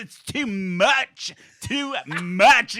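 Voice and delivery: high, loud, slow and theatrical; dramatic complaint. Retold or imitated. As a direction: throw up your hands, overwhelmed. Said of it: "at any given moment and goes".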